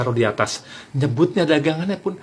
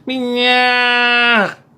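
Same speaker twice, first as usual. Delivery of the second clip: long, loud, high held call; shrill kerosene-seller cry; 9 semitones higher, 4 dB louder than usual. Shown as a demonstration. How every kerosene seller calls, piercing drawn-out cry. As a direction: pull the word up high and hold it. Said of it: "Pasti begini".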